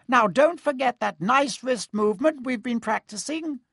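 high squeaky little professor; squeaky Flitwick. Acted. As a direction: squeak it out, tiny and eager. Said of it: "squeaked Professor Flitwick".

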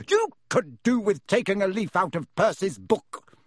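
loud, high scolding yell; yelling mother. A narrator's reading. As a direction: jab each word like a finger. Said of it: "yelled Missus Weasley".

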